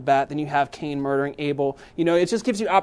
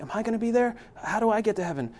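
lightly voiced child, higher pitch; higher, childlike questioning; imitating his young son; 7 semitones higher, faster than usual. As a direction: ask it a little like an earnest small boy. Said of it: "he's like".

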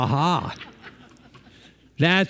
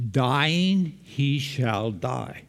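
very slow, each word laid out deliberately; slow, weighty reading of Dying he shall die; literal Hebrew wording; much slower than usual. Shown as a demonstration. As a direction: read it word by word to show the Hebrew idiom. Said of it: "So in Hebrew it says".

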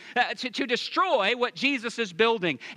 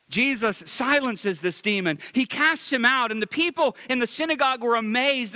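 normal talking voice

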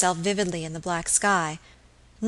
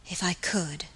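soft, under the breath; muttering; 2 semitones lower, 9 dB quieter than usual. A narrator's reading. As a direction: mutter it to herself. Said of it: "she muttered".

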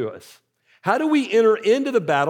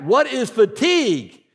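slow mock yell, pitched up; retold coach's yell; 4 semitones higher, 3 dB louder than usual. Coach quizzing his team, slow mock yell. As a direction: holler the drill question slowly, imitating a coach. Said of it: "he yells back".

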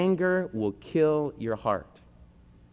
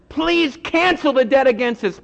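high, loud plea to cancel the debt; desperate prayer, pitched high; pleading; 9 semitones higher, 4 dB louder than usual. Acted.

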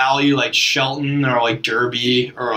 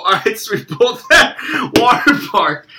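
laughing nervously, higher voice; nervous laughter; 7 semitones higher, 3 dB louder than usual. Real, not acted. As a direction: giggle it out nervously. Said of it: "it's nervous laughter".

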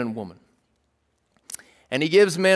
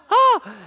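short, high-pitched pretend fright; mock startled gasp; 5 dB louder than usual. Dad pretends toddler's dinosaur scared him, mock startled gasp. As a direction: give a quick playful yelp. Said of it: "I'm like".

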